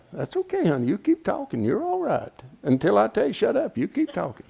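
high, soft and affectionate; soft, fond aside to his sister; gentle teasing. Real, not acted. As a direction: soothe her while teasing. Teases sister in congregation.

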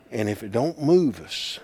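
ordinary delivery

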